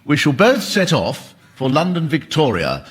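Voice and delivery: ordinary talk, nothing marked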